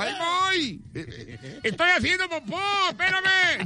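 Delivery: high voice calling from another room; wife calling back. Acted. Laura calls from the bathroom to her husband, high call. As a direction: answer from far away, stalling him.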